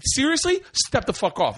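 high, incredulous brush-off; retold bluster. Bluffing at the actor before walking away, high mock bluster.

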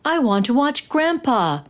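put-on a boy pleading back at his mother; protesting. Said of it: "he protested".

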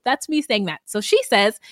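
ordinary delivery

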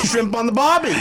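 high, hammed-up Aussie accent; a cheesy Australian put-on; mock Australian accent. Co-host jokes in a mock Australian accent.

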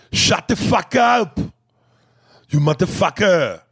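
put-on high, loud, drawn-out Austrian-accented yell; hammed-up action hero bellow; Schwarzenegger. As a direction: shout it as a mock Austrian strongman. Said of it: "Hearing Schwarzenegger tell someone".

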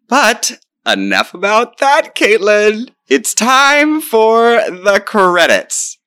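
high, slow, showy announcer; hammed-up grand announcement. Mock-grand cue for the credits.

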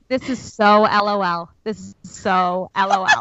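mock stunned repeat. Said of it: "I was going".

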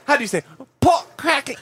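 high, put-on British accent; mock-posh British accent, pitched high. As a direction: order food in a hammy British accent. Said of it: "doing this British accent".

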